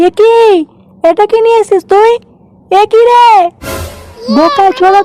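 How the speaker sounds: furious. Acted. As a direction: scold him in outrage. Furious at cheap liquor.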